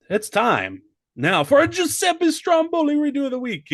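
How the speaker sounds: big high announcer intro; high show-host announcement. Acted. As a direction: present it like a game show host. Announcing the segment.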